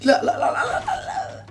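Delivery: high breathless shrieks breaking into a laugh; panicked shriek. Real, not acted. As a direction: squeal in fright, then laugh it off. Jump scare, shrieking then laughing.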